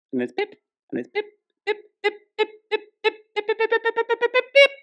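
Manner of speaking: rapid, high-pitched pip noises like a buzzing phone; imitated phone beeps. Imitating endless phone notification beeps.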